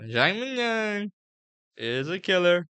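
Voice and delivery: high, drawn-out Trump voice; Trump impression. Shown as a demonstration. Vlogger hyping a fighter as Trump, high Trump impression. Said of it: "I'll talk like Trump".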